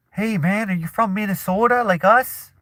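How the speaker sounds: high-pitched mock Minnesotan; Minnesota accent. A local accosting the singer, high mock Minnesota accent. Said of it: "he didn't want anyone to be like".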